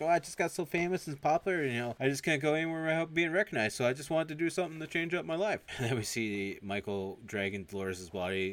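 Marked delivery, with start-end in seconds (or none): 0.0-5.6 high, put-on voice of a bored star; whiny celebrity voice. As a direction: complain like a spoiled famous man. Killer explains himself.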